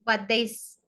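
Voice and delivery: ordinary talk, nothing marked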